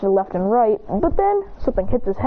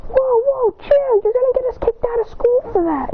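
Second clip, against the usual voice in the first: high-pitched urgent objection; alarmed protest; 13 semitones higher than usual. Acted. Friend fears being kicked out of school, alarmed protest. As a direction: pitch it right up to stop him.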